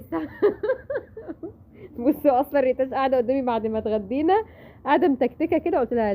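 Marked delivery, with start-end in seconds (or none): 0.2-1.5 a quick high-pitched laugh. Real, not acted. Mother laughing at her daughter's answer, high giggle.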